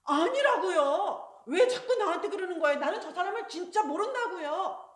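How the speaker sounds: loud, slowed then rushed angry protest; angry denial. Acted. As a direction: blow up at the accuser. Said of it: "그러니까 베드로가 화를 냈어요".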